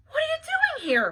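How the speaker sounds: very high, loud surprise; acted high-pitched disbelief; astonished question. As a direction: blurt it out in amazement. Pep finds Maya waiting.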